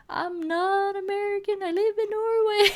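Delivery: high mock complaint; mock protest. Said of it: "and I'm like".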